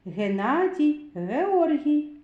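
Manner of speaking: usual speaking voice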